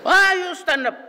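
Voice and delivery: imitating angry teacher; shrill old professor. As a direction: bark at the student in a shrill high voice. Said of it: "boses, ano, siya, shrill".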